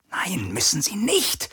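slow, insistent whisper; urgent whisper. A narrator's reading. Garland secretly contradicts his captain, in an urgent whisper. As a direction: whisper it urgently, stretching the words. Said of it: "flüsterte Garland drängend".

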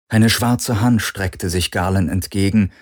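ordinary delivery, nothing marked

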